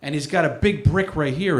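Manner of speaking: normal talking voice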